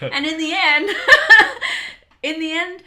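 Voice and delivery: high, loud through laughter; real laughter; laughing. Real, not acted. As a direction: laugh as you say it. Laughs mid-story.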